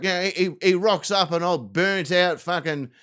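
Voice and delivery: ordinary talk, nothing marked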